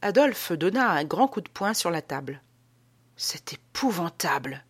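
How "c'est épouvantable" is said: exclamation rauque, presque sans timbre; voix rauque. Acted. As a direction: effroi étouffé dans la gorge. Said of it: "s'écria-t-il d'une voix rauque". (s'écrier d'une voix rauque et retenue)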